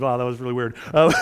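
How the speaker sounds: real laugh through the words; amused laughing aside. Real, not acted. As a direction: chuckle out the words, still amused. Laughing at his slip.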